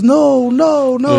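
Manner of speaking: high, mock-tearful child voice; imitated bawling. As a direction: refuse like an inconsolable little girl. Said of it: "bawling. And she goes".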